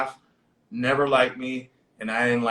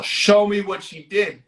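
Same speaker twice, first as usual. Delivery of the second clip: higher-pitched retold order; insistent demand; 6 semitones higher, 3 dB louder than usual. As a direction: press the demand as the sheriff. Sheriff repeats demand after being questioned.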